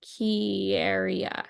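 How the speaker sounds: Quería stretched out very slowly, each syllable ki-e-ri-a placed with care; careful pronunciation. Shown as a demonstration. Teacher retrying a Spanish word, slow and careful. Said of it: "Hold on, bring it back".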